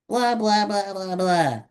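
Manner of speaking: high, hammed-up blah blah sounds; mock retching. Carsick child fakes retching in the car, high. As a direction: play at being carsick.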